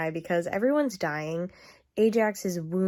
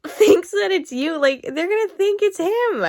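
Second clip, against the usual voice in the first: higher and louder, furious disbelief; infuriated rant; 6 semitones higher, 6 dB louder than usual. Real, not acted. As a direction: rant at the book, fuming and loud. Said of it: "I am infuriated right now".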